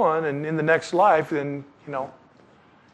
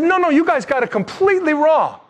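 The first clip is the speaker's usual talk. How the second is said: high, loud rebuke; a preacher voicing Jesus, high and forceful; Jesus rebuking; 10 semitones higher, 4 dB louder than usual. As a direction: scold them firmly for getting it wrong. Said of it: "But Jesus said".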